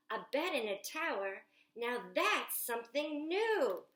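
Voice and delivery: put-on lively picture book read aloud to children; storytime reading. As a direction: read it like story time at the library, bright and eager. Reads Jack's lines aloud.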